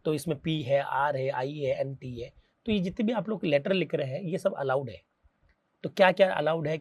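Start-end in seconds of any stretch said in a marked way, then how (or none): 0.4-2.3 spelling letters slowly. Shown as a demonstration. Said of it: "P R I N T print".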